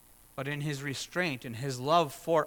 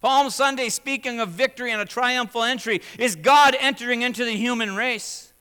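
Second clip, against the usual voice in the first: louder, higher impassioned sermon; full-force preaching; 9 semitones higher, 9 dB louder than usual. Acted. Pastor urges the congregation, full-force preaching. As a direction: drive it home from the pulpit.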